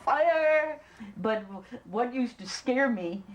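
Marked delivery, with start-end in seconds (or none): 0.1-0.8 high drawn-out mock holler; retold holler. Warning of a blast to come, hollered high. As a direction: call out a warning, stretched and high. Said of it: "you'd start hollering".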